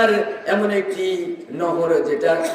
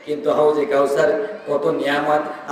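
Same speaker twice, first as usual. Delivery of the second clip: ordinary talk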